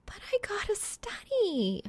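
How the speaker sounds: high whiny whisper. Acted. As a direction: plead it in a whisper. Reaper protests about studying.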